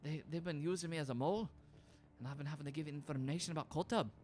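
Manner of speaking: lightly voiced, uneasy young woman; a touch of [name] fear. [name] confides fears to Linnea.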